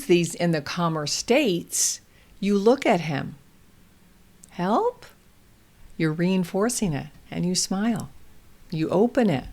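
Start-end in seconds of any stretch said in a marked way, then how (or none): 4.5-5.0 HELP lifted high into a question; questioning prompt. Acted. Parent looks at toddler, prompting HELP as a rising question. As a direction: offer HELP gently, rising at the end.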